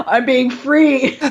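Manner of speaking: slow, laughing mock defiance. Friend plays caught drinker, laughing. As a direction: giggle it out proudly.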